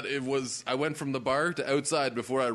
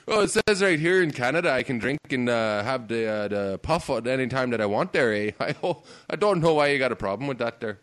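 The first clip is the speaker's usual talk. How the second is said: hammed-up Canadian accent, louder, with an "eh" tacked on; mock Canadian accent; 5 dB louder than usual. Joking to a friend about Canadian laws, in a mock Canadian accent. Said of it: "I thought you were Canadian and now you're Irish".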